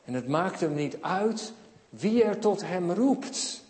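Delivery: usual speaking voice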